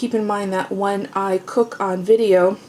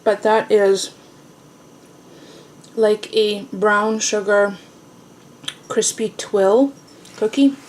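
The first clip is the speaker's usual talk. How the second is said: talking through a mouthful of muffin; chewing while speaking, mouth full; slower than usual. Real, not acted. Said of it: "talk with my mouth full".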